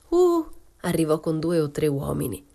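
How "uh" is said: a sudden high, loud "Uh!"; shouting. Acted. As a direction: yelp it out from across the beach.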